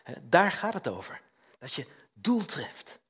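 usual speaking voice

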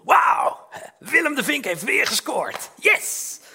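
loud, high mock cheer. Teenager imagining the crowd after his arrow hits, loud mock cheering.

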